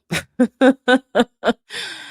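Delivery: a wry high laugh at herself; high rueful laugh. Real, not acted. Rueful laugh after thanks.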